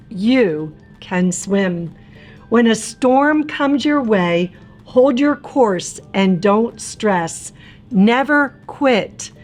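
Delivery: ordinary delivery, nothing marked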